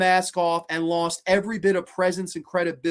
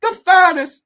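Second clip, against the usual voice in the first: much higher, loud frantic daddy voice; mock Mister Salt; 9 dB louder, slower than usual. Mocking Han's death as Veruca's father, in a high frantic voice. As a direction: shriek like a panicked doting father. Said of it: "like he's fucking Veruca Salt".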